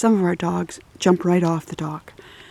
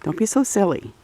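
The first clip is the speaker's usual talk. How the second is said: mock reply to the dog; 8 semitones higher than usual. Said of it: "I'm like".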